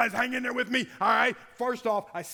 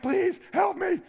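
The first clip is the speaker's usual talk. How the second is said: mock desperate plea; 10 semitones higher, 7 dB louder, slower than usual. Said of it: "you're like".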